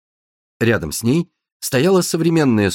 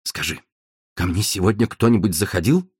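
almost solemn; 4 semitones lower than usual. Acted. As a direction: ask it like a grave ceremony. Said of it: "почти торжественно произнёс он". (почти торжественно, тихо)

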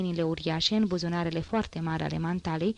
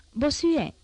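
calling out; 8 semitones higher than usual. A narrator's reading. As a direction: hail a friend down the street. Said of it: "strigă Courfeyrac".

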